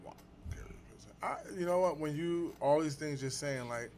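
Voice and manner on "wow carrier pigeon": whispered in mock amazement; awed whisper. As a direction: breathe it like a hushed wonder. Mock awe at a joke.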